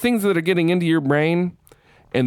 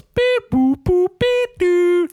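high sung beep boop notes; 4 dB louder, much slower than usual. Retold or imitated. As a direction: chirp the notes up high like a toy piano. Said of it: "she plays five notes".

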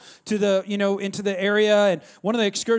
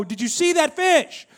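high, slow, stretched-out panicked question; mock-terrified, drawn-out exclamation; alarmed cry; 5 semitones higher, 3 dB louder, slower than usual. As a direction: cry out in exaggerated disbelief and alarm. Reenacts alarm to the guides.

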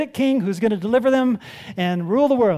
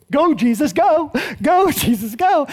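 louder, higher chant of go, Jesus, go; cheer; 5 semitones higher, 5 dB louder than usual. Acted. Preacher cheers Jesus on like a sports fan, excited chant.

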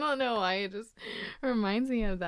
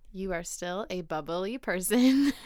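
slow and clear. Shown as a demonstration. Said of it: "Let me slow down".

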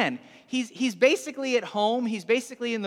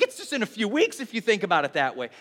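normal talking voice

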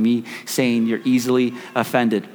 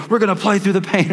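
lightly voiced coach, higher pitch; a hint of a pushy coach rallying players; 6 semitones higher, 3 dB louder than usual. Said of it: "your coach was like".

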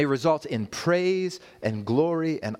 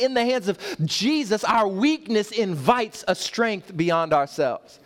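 high, louder preaching rising to a climax; full-force preaching; 6 semitones higher, 3 dB louder than usual. Acted. Pastor urging the church toward faith over resources, climactic preaching. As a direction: build each "in the hands of Jesus" higher.

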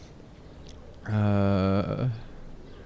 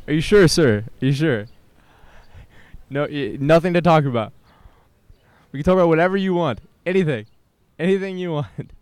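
higher, louder, fast pleading; eager plea; 4 semitones higher, 5 dB louder than usual. Real, not acted. Pleading with a passerby.

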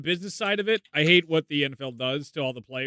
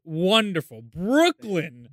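high, loud, slow showman intro; hammed-up introduction; 6 semitones higher, 6 dB louder, much slower than usual. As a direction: announce him like a ring announcer.

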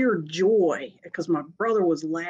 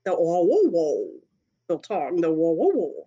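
husky howl imitation; 3 dB louder than usual. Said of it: "Siberians don't bark".

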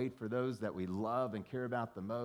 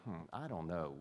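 doubtful; 6 semitones lower, 5 dB quieter than usual. Retold or imitated. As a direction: lower and softer dubious hum. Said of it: "you may be like".

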